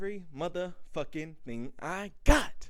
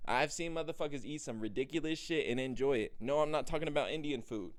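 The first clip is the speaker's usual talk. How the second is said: ordinary talk, nothing marked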